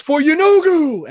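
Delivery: high, loud, drawn-out war cry; lone champion shouting to the crowd; battle-cry shout. Acted. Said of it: "and he shouts".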